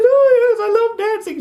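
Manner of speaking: put-on high, delighted girlish voice; squealing falsetto lady scorpion; swooning female scorpion. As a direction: gush it like a thrilled date. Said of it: "She goes".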